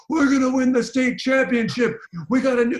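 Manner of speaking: shouting it down the hallway, high and loud; retold scream. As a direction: yell it like an overexcited kid announcing to everyone.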